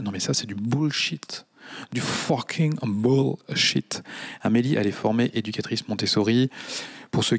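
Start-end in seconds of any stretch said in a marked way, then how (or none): 1.9-4.0 each word slowly stretched for scorn; drawn-out dismissal. Acted. Host scoffing at the six-month training, stretched out. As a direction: spell out the contempt word by word.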